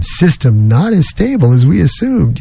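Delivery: ordinary talk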